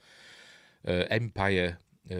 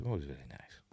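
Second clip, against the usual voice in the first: whisper; 8 semitones lower, 13 dB quieter, faster than usual. Real, not acted. Repeats the compliment in a whisper.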